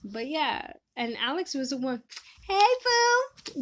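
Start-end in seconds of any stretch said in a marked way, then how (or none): 2.5-3.6 very high, loud sing-out hello; playful high call; high-pitched greeting. Real, not acted. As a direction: squeal a hello to a close friend. Calling out greeting to friend.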